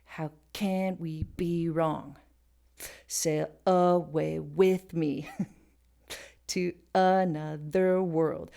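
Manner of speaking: slow, "can", "be", "with" stressed against natural speech; stress on offbeat syllables. Shown as a demonstration. Teacher showing the song's odd emphasis, slow displaced stresses. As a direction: hit "can", "be", the "a" of "away", "with". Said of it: "places that we would never put emphasis".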